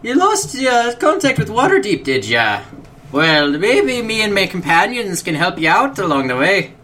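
high, shaky-confidence Scottish attempt; bad Scottish accent. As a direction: have a go at a Scottish dwarf. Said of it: "I'm even worse as Scottish".

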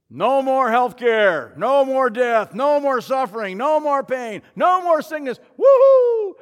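crowd cheering the king, loud and high; shouting. Acted. Palm Sunday crowd hailing their king, excited shouting. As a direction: shout it out in jubilation, building to a whoop. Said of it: "they're all shouting".

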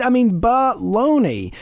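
drawn-out scornful baloney; emphatic baloney. Real, not acted. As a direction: hammer it home a second time. Repeating the dismissal.

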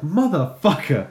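acted fed-up outburst; exasperated swearing. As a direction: groan it out slowly in mock fury. Retells reaction to another school call.

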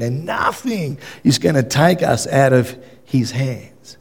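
ordinary delivery

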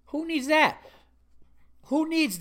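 high-pitched, indignant outburst; incredulous griping; exasperated question. Real, not acted. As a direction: throw up a fed-up rhetorical question. Exasperated rhetorical question ending rant.

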